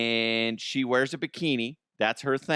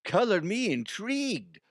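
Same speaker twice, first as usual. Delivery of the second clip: intrigued; 8 semitones higher than usual. Retold or imitated. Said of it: "You're like".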